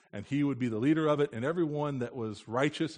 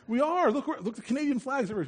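high, loud and quick, pointing out flags; excited insisting; 13 semitones higher, 6 dB louder than usual. Retold or imitated. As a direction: insist eagerly to a stubborn child.

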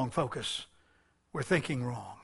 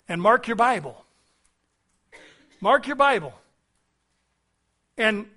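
loud, raised-pitch command; emphatic exhortation; 6 semitones higher, 8 dB louder than usual. Real, not acted. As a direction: drive the instruction home from the pulpit. Preacher repeats urgent instruction.

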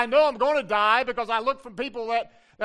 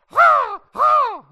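loud high cawing crow imitation; 12 dB louder than usual. Shown as a demonstration. Preacher cawing like a crow for the congregation. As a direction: caw like a screaming raven. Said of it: "make the crow sound".

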